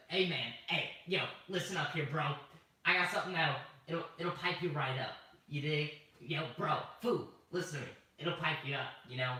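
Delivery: a slangy street dude, lightly voiced; pushy bro voice. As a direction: sell it like a sketchy guy with something in his pocket. Coworker pushing something, voiced as bro.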